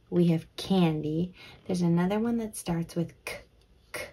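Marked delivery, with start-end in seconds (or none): none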